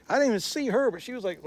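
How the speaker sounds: usual speaking voice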